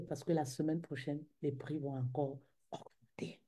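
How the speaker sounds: hushed secretive whisper. Real, not acted. Whispered aside.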